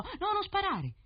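high, urgent cry of alarm; pleading high-pitched crow's shout. A narrator's reading. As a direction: cry out high as if begging the hunter to stop. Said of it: "il corvo gridò".

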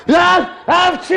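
high building comic sneeze. Acted. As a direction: wind up into a big fake sneeze.

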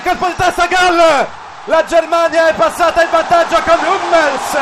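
excited goal call. Real, not acted. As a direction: burst out as the ball hits the net. Goal scored, commentator erupts. (grido del gol eccitato)

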